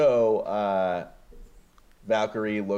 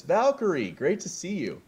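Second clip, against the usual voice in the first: cheery greeting; 9 semitones higher than usual. Retold or imitated. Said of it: "Smart Hulk says".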